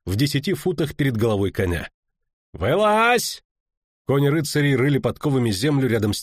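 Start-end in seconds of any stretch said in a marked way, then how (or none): 2.5-3.4 high, commanding battle shout. A narrator's reading. Knight to hidden travellers, shouted command. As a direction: order them out in a high yell.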